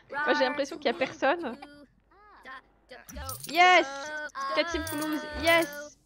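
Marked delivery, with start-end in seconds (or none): 3.5-6.0 loud, high burst of joy; excited cheer. Real, not acted. Streamer cheering as Simflouz roll in, excited. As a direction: celebrate the cash like a win. Money arrives in game.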